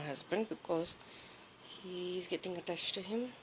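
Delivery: soft unvoiced whisper. Real, not acted. Owner whispering while filming her scared cats, softly unvoiced. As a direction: whisper so the cats aren't disturbed. Whispering near sleeping pets.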